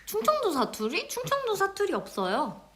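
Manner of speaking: high, incredulous denial; indignant surprise. Real, not acted. Denying a dialect to viewers, high indignant surprise. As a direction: act shocked at the accusation. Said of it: "충청도 사투리 쓴다고?".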